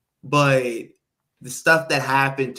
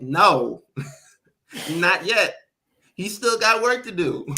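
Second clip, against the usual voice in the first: mock Roman; 5 semitones higher, 3 dB louder than usual. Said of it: "And Roman's like".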